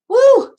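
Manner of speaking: a sudden high, loud woo; high whoop of joy. Real, not acted. Reader cheering during the card pull, high loud whoop. Excited outburst during reading.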